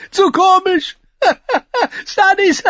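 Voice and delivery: very high, loud gasps between laughs; helpless laughter. A narrator's reading. Tyrion telling his sister it's too funny, helplessly laughing. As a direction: force out words between fits of giggles. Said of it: "einige Worte hervorzustoßen".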